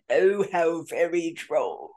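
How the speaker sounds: put-on slow, laughing British-accented quip; mock British drawl. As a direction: play a dry British gent amused, in a British accent. Joking Q-style British reaction.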